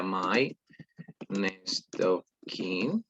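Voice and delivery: "next of kin" drawn out word by word, very slow; slow model phrase. Shown as a demonstration. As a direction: spell out "next of kin" slowly for the class. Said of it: "Esta palabra no tiene guiones". (pronunciación lenta de "next of kin")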